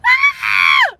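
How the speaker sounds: very high, loud shriek; excited scream. Real, not acted. Collector thinks of her two finds, excited scream. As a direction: let out a delighted scream. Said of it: "so excited".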